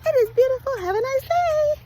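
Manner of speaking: cheery video greeting. Retold or imitated. Recording her first morning message, cheery high greeting. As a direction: chirp it like a bright morning host. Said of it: "I picked up my phone and I was like".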